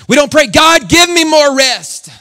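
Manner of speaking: high, loud preacher's cry; shouting sermon pitched high; full-force preaching. Acted. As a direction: preach it out to the rafters.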